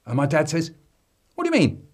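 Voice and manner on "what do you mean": sharp high questioning dad; incredulous father quizzing his son. Retold or imitated. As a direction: play the suspicious father.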